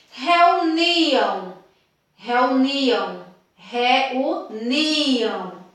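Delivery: reuniam drawn out with a long NI; teacher's deliberate reuniam, NI stretched; stress on NI in reuniam. Shown as a demonstration. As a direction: lean hard on the NI of reuniam.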